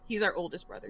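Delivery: ordinary talk, nothing marked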